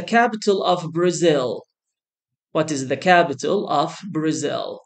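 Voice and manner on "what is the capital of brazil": ordinary talk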